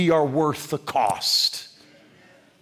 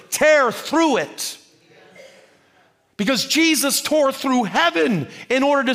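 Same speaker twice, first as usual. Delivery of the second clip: preaching climax; 6 semitones higher than usual. Acted.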